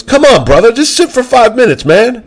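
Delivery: mock Jon Bernthal begging; Bernthal imitation. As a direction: beg him to stay, hammed up. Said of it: "And Bernthal goes".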